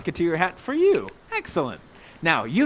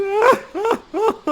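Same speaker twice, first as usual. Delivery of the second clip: loud, high theatrical sobbing; mock wail; 7 dB louder than usual. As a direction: break into a hammed-up wail. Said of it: "and you're crying".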